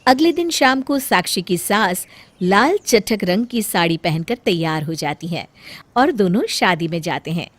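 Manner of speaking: usual speaking voice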